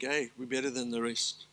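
usual speaking voice

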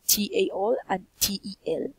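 letters T A L and T E L spelled slowly one by one; teacher spelling letters for pronunciation. Shown as a demonstration. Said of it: "Pay attention to the pronunciation of".

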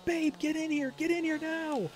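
high, urgent call for his wife; excited call to wife. Retold or imitated. Calling his wife in, high and urgent. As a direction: yell for her to come right now. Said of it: "And the way I shouted".

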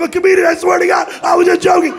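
high, loud mock-desperate protest; panicked pleading. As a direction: plead innocence in a shrieking panic. Pleading as he gets arrested.

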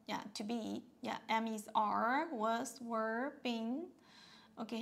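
usual speaking voice

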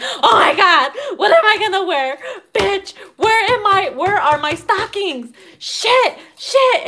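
high, loud, laughing frenzy; frantic panic. Retold or imitated. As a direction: scramble in a squealing rush. Frantic getting ready for date.

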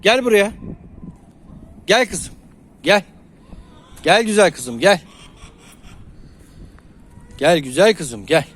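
loud, high call across the yard. Real, not acted. Owner calling a hen back from the field, high loud call. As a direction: call her over from afar.

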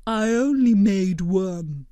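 slow, earnest blob creature voice; gentle, measured cartoon creature; Blobbert voice. A narrator's reading.

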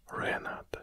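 usual speaking voice

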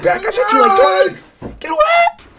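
very high, loud frightened cry; panicked yelp. Real, not acted. Player startled by an enemy that won't die, screaming. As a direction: shriek it as if something lunged at you.

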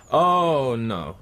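a short disbelieving laugh that sours into "no"; bitter laugh. Acted. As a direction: laugh at your own foolishness, then drop. Pilot realises it's about weapons.

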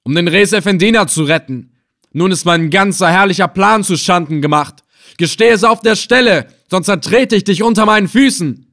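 loud, high, raging outburst; furious threat. A narrator's reading. Captor demands confession from prisoner, furious threat. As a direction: threaten him at full voice.

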